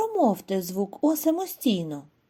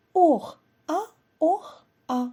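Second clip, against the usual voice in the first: wheezing donkey; 6 semitones higher than usual. Acted. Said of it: "охрип".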